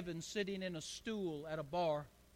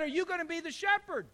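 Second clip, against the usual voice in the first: higher, amused, fast mother's question; a mother humoring her son; lightly voiced mother; 9 semitones higher, 4 dB louder, faster than usual.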